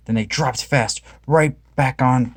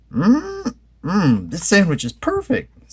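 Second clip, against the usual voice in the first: high, slow, savoring Shaggy; Shaggy savoring food; 9 semitones higher, slower than usual. A narrator's reading. Shaggy enjoying his sandwich, high and slow. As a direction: draw out the yum. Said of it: "said Shaggy".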